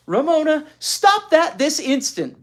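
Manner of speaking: high, slow, bossy command; stern big sister order. A narrator's reading. Big sister orders Ramona to stop, loud and bossy. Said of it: "Beezus ordered".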